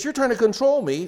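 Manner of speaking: mock the wife's complaint; accusing wife. Wife accusing her husband of control, high and loud. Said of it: "if she says".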